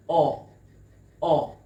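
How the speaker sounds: "oh" as a loud, separate "uh"; exaggerated short U. Shown as a demonstration. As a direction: punch out the "oh" vowel as in umbrella. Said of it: "exaggerate the mouth movement".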